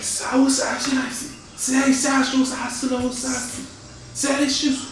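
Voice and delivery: very high, sing-song nonsense words; high dryad gibberish. Acted. GM voices dryad speaking Sylvan to the party, high singsong. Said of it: "she begins to speak to you".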